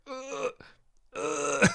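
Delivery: high straining grunts; effortful ugh, ugh, pushing against weight. Retold or imitated. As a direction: strain as if lifting a heavy head. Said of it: "trying to get my head up".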